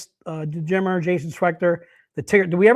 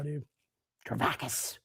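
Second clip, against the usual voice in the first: usual speaking voice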